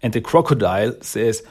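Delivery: ordinary talk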